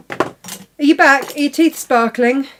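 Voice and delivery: high, loud, drawn-out teasing greeting; playful call. Real, not acted. Host teasing co-host back from brushing teeth, high loud playful call. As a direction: sing out a cheeky welcome back.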